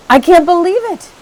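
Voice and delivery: high, loud cry of joy; loud, high, overjoyed cry; excited cry. A narrator's reading. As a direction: burst out in disbelief. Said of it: "he cried".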